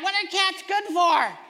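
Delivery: ordinary talk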